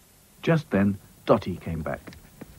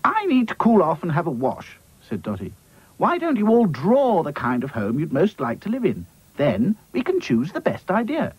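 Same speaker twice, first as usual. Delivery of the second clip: Dotty's voice; 9 semitones higher than usual. Acted. Dotty speaks.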